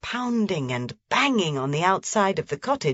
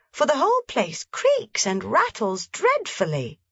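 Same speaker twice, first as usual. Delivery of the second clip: put-on higher, eager little girl; eager child; 9 semitones higher than usual. A narrator's reading. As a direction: press grandfather with childish urgency. Heidi pleads with grandfather.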